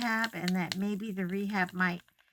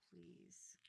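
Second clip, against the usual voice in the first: whispered plea; 2 semitones lower, 30 dB quieter than usual. Real, not acted. Pleading under breath.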